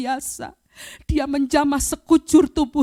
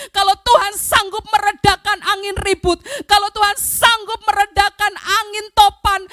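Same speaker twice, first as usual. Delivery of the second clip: raised, urgent shouted plea; crying out to God; 7 semitones higher, 5 dB louder than usual. Retold or imitated. Coughing sufferer begs Jesus for healing, crying out. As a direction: plead loudly with full conviction, pitched up. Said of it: "Saya teriak kepada Tuhan Yesus".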